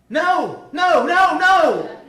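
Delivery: high, very loud shouted no; retold shout of no. Tester cuts off her teaching plan with a loud shout. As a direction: blast the no's like an outraged official.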